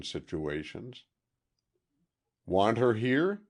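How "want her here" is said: laconic. A narrator's reading.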